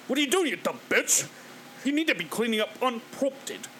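lightly put-on British voice, scolding; a hint of a posh Brit scolding; mocking the guy's British accent switch. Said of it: "that's another accent switch".

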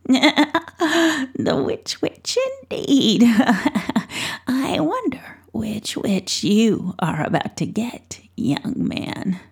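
lightly voiced sly old witch, half-laughing then whispered; a hint of a teasing crone. Old witch teasing Pell about his visit, lightly croaky.

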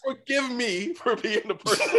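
hammed-up Daemon fake apology; mock Daemon sarcasm. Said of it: "Damon's like".

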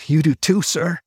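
high, gasping reply. Acted. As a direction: gasp the words out. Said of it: "Arrow gasped".

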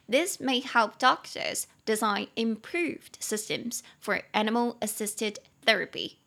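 slow, clear read of the sentence; slow model reading. Shown as a demonstration. Teacher reading the example sentence slowly aloud. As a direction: read it slowly for learners to follow. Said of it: "reading the model sentence".

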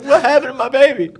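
high, loud, rushed mock panic; alarmed reply. Husband reacting to wife's news about baby, mock alarm. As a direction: blurt it out in mock parental alarm.

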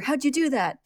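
high, loud astonished question; mock shock. Barry shows Al how to react, mock shock. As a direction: play up the amazement, pitched high. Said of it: "should at least be shocked".

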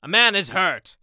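urgent shouted alarm, high and loud; top-of-the-voice cry; shouting. Acted. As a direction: call it out across the field. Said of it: "called out at the top of his voice".

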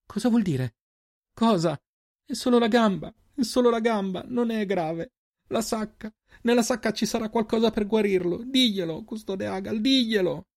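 very high, desperate crying plea; tearful, words broken. A narrator's reading. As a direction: plead in broken bursts as if weeping. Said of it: "le parole spezzate dal pianto".

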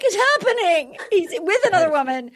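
high-pitched mock panic; freaking out. Host imitates contestant freaking out over a rival's date, high mock panic. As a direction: wail it like a contestant spiraling. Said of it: "I'm just freaking out".

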